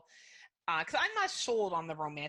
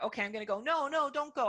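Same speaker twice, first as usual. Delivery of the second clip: higher-pitched mock protest; acted pleading; 7 semitones higher, 3 dB louder, faster than usual. Partner replaying a couple's fight, pleading. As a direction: act out an urgent plea to stay.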